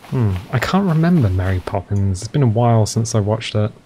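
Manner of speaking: normal talking voice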